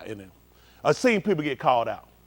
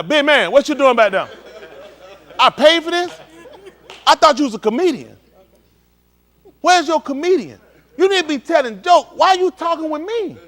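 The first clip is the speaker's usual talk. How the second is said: loud, high, indignant, with laughter; mock offended heckled guy; 8 semitones higher, 8 dB louder than usual. Heckled audience member answering the comedian, loud and indignant. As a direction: play the picked-on man talking back, laughing.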